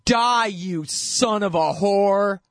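high, drawn-out mock scream; imitated scream. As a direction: imitate a jeering scream, slow and high without full volume. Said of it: "they're screaming at him".

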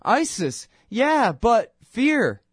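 high, slow drawn-out whine; mock whining interviewer. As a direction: drag out fear like a scared host. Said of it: "And the Fox News guy's going".

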